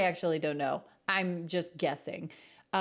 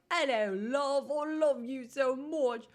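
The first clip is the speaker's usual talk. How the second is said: a lightly put-on Cockney accent, a touch higher; chirpy mock Cockney lover, hammed up; Bert Cockney accent; 6 semitones higher than usual. Teasing her English husband with a mock Cockney accent. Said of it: "Bert from Mary Poppins".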